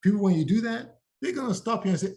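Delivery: ordinary talk, nothing marked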